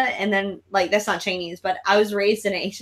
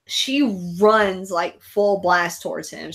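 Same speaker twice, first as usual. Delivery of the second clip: ordinary talk, nothing marked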